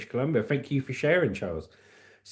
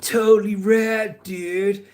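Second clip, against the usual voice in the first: higher, slow, stretched surfer-dude drawl; California surfer; 3 semitones higher, much slower than usual. Acted. Streamer adding surfer for Cali, drawling surfer dude. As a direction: drag out the vowels like a surfer. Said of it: "throw some surfer in there for Cali".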